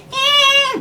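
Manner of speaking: loud, piercing imitation of a rock singer; short falsetto mock wail; high-pitched squeal. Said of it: "that high-pitched".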